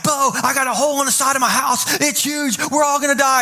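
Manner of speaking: hammed-up alarm, as if disaster has struck; freaking out. Pastor retelling his call to his best friend, mock panic. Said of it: "I am freaking out".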